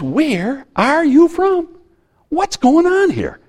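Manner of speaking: high bewildered official; Pilate, puzzled. Retold or imitated. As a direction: ask it up high and slowly, baffled by the prisoner. Pilate's question acted out.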